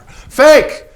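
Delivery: very high and loud yell; enraged painter screaming at the photographer; screamed insult. Acted. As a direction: scream the word at him. Shouting insult in quarrel.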